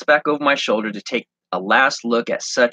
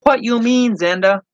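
much higher, puzzled cashier in broken English; Ling's voice; 13 semitones higher, 4 dB louder than usual. Acted. Cashier Ling puzzled by Zenda's remark, high and innocent. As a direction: ask him innocently, in halting English. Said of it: "Ling, the cashier".

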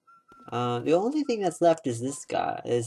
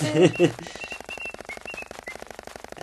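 giggle; 6 semitones higher than usual. Real, not acted. Laughs at own chipmunk voice.

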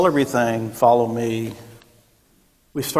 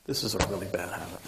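much softer, quick mumble; quiet aside; 7 semitones lower, 14 dB quieter, much faster than usual. Real, not acted. Preacher confessing a habit, soft fast aside. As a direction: throw it away under your breath. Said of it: "I'll try to stop".

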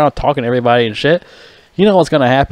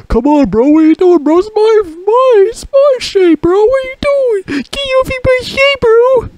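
much higher, louder squealing whine, slow; mocking man-baby; 4 dB louder, slower than usual. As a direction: squeal it like a grown man throwing a tantrum. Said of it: "I'm like".

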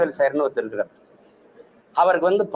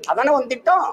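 sarcastic challenge; 9 semitones higher than usual. Real, not acted. Mocking accusation, rising.

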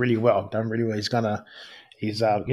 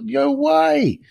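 amazed. Retold or imitated. Retelling his reaction to Michael's buck, high and amazed. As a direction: squeal it in disbelief.

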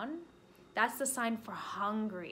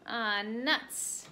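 plain reading voice

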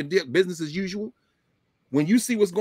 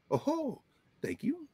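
soft, high-pitched acknowledgement; upward inflection; 9 semitones higher, 10 dB quieter, much slower than usual. Shown as a demonstration. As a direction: lift the pitch gently, keeping it soft. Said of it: "Often the upward inflection".

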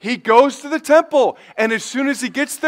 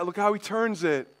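normal talking voice

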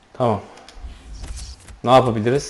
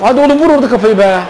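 quick, higher-pitched urging to head the ball; excited urging; 6 semitones higher, faster than usual. Real, not acted. Gamer urging his striker to head the ball, excited and fast. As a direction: hurry him on, pitch up.

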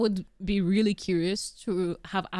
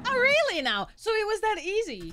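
mock surprise; 13 semitones higher than usual. Mocking high surprised reaction.

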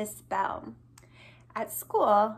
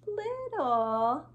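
little voice; 2 semitones higher than usual. Shown as a demonstration. Teacher contrasting opposites for children, in a little voice. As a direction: make the word sound small.

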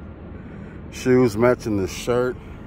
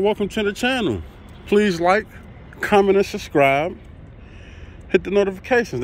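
voicing the dog; 8 semitones higher than usual. Voicing the dog's greeting.

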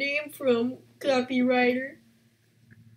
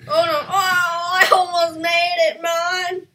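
higher, louder near-miss groan; frustrated shout; 5 semitones higher, 7 dB louder than usual. Real, not acted.

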